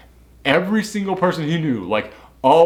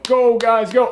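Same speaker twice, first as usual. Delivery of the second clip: higher, cheering them on; mock cheering; 9 semitones higher, slower than usual. Cheering on the thugs.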